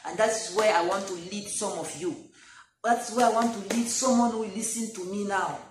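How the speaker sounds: normal talking voice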